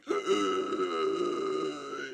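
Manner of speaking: a heavy gasp, as if wilting in the heat; mock heat gasp. Gasping while lying in the heat. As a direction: gasp like the heat is too much. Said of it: "I've been lying here going".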